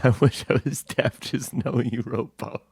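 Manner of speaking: soft, spent restatement; subdued disbelief. Real, not acted. Host repeating his complaint to friend, subdued disbelief. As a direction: say it quietly, worn down by dismay. Same line repeated after outburst.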